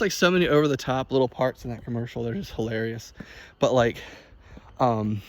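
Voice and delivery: ordinary talk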